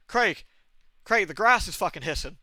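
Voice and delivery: acted-out urgent report; retold alarm. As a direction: call out to dad in mock alarm. Retold alarmed call to friend's dad.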